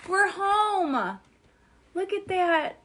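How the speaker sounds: high, glad and bright; overjoyed at being home; joyful. Acted. Dorothy's joy returning home.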